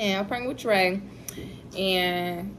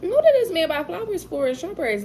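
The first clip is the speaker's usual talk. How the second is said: suspicious outburst; 12 semitones higher than usual. Retold or imitated. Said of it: "I'm like".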